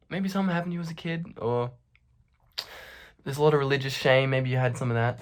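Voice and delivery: soft, quick, hesitant suggestion; low-key doctor offering awkward guesses; tentative doctor. Retold or imitated. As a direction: float it quietly, unsure. Retold doctor gently suggesting causes.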